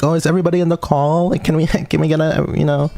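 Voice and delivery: high bubbly voice. Retold or imitated. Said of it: "They were like".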